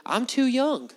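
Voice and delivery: high-pitched mock boyish excuse; protesting youngster. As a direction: plead it in a small, high youngster's voice. Acts young Jeremiah's excuse.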